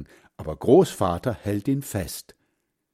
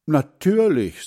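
put-on higher, louder boy's answer; 6 semitones higher, 5 dB louder than usual. A narrator's reading. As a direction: answer proudly like a small boy. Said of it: "sagt Hans Frieder".

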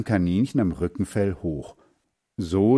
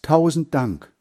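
higher, louder called-out thanks; calling out thanks; 6 semitones higher, 5 dB louder than usual. A narrator's reading. Max thanks Hans Frieder for the marble, calling out. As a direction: call it out to a departing friend. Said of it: "rief Max".

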